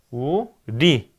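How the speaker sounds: '우, 리'를 한 음절씩 천천히; '우'와 '리' 사이를 띄워서. Shown as a demonstration. 교사가 음절을 끊어 시범. ('우, 리'를 받아쓰라는 듯 느리게 나눠서)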